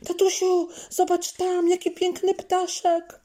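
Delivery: put-on high, loud excited child's call; a small girl shouting to her dad about a bird; little girl calling out. A narrator's reading. Zuzia calling her dad to look at a bird, high and loud. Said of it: "zawołała Zuzia".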